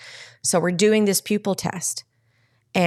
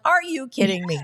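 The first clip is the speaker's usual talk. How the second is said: incredulous; 6 semitones higher than usual. Retold or imitated. Retells her disbelieving reply to teen.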